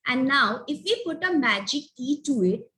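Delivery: usual speaking voice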